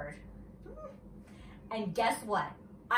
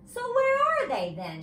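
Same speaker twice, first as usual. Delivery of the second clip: put-on high, louder surprised girl; surprised question; 6 dB louder than usual. A narrator's reading. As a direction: ask it in a squeak of surprise. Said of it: "Lucille looked surprised".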